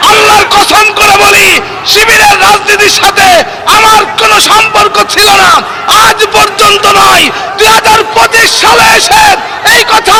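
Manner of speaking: fiery waz shouting against a heckler; preaching at full force. Real, not acted. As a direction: roar the sermon in righteous anger.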